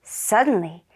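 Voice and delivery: plain reading voice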